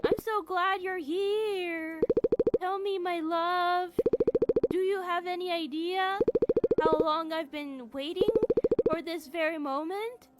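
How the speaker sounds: eerie mermaid. Acted. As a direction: croon like a creepy siren. Mermaid Rosetta speaks to him.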